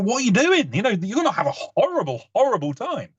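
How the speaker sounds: high-pitched, appalled warning; mock exasperation. Brit warning tourists about their itinerary, exasperated.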